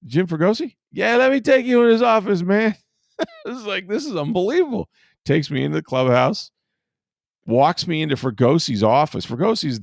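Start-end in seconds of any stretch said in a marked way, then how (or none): 0.0-2.8 much higher and louder, friendly ballplayer; mock-friendly manager imitation; Ozzie Guillen. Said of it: "he goes". 3.2-4.9 much higher, laughing disbelief; mock-awed reporter; amazed.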